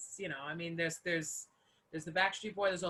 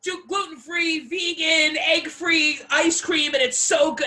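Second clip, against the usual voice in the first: loud, high, gushing sales pitch; overexcited pitch voice; 8 semitones higher, 8 dB louder, slower than usual. Retold or imitated. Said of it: "Ken and Barbie doll".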